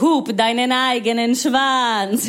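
slow, drawn-out heckle; hammed-up driver's insult, slow and emphatic; mock road rage. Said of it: "ihr seid so".

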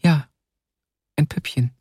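put-on shy little girl's reply; small, bashful child voice. A narrator's reading. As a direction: answer timidly as a small girl. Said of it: "antwortete das Mädchen schüchtern".

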